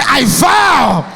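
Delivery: high, slow, full-throated rallying cry; rally the hall to its feet; rising shouted vow. Shown as a demonstration. Leads a shouted affirmation.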